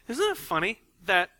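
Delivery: ordinary delivery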